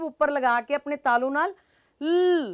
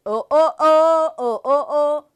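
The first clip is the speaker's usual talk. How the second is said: loud oh oh chant; mock surprise; 6 dB louder than usual. Shown as a demonstration. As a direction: call oh at the goat. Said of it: "billy goat chant". (ਉੱਚੀ ਓ ਓ)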